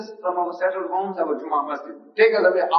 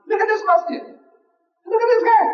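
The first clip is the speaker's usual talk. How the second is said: mock alarm; 5 dB louder than usual.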